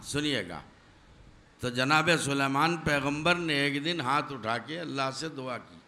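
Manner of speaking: normal talking voice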